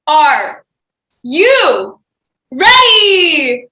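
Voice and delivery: building to a big loud shout; hype shout. Acted. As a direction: rev up the crowd. Host hypes up audience.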